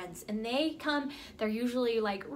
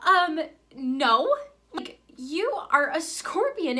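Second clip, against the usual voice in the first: lightly acted disbelief, raised pitch; a frog's pointed, slow refusal; incredulous frog; 4 semitones higher, 5 dB louder, slower than usual. Frog turns down the scorpion, mildly incredulous. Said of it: "this frog looks at the scorpion and he says".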